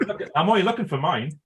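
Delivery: usual speaking voice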